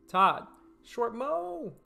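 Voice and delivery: playful drawn-out reading. Pretend. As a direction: stretch it out like a joke. Reading a garbled chat comment.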